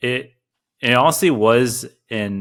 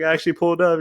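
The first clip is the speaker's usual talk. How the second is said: normal talking voice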